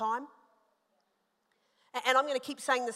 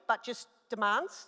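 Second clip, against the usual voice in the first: ordinary delivery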